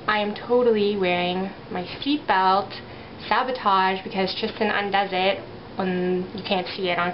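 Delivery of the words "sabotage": usual speaking voice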